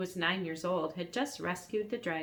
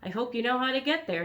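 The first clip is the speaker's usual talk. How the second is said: quick dragon voice; 6 semitones higher, 5 dB louder, faster than usual. Acted.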